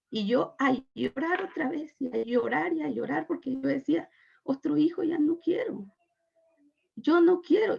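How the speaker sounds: reliving the despair, near crying; tearful. Real, not acted. Retelling crying over unwanted pregnancy.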